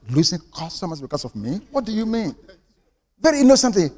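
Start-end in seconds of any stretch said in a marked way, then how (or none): 0.1-2.3 bewildered. Retold or imitated. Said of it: "Young man, bewildered".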